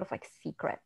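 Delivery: hushed, breathy whisper; whispered secret. Real, not acted. Describing hidden teen romance.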